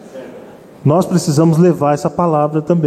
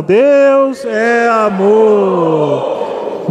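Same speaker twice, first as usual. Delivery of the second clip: DEUS, É and AMOR each stretched very long; long, sustained call to the congregation; led congregational declaration; 3 semitones higher, much slower than usual. Shown as a demonstration. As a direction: proclaim it extremely slowly, holding every word for the church to join. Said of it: "Vamos falar, irmão".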